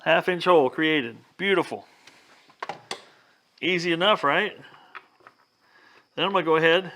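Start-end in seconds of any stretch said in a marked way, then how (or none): none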